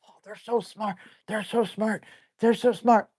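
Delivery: soft sing-song mockery of dog fans; mock gushing. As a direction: parrot the hype with a smirk. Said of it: "everyone's always raving about how smart".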